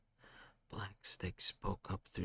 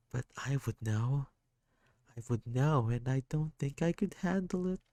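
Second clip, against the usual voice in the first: put-on lightly higher, sorrowful child voice; a hurt kid apologising, voice lifted; sad kid; 5 semitones higher, 5 dB louder than usual. Sad kid character speaking.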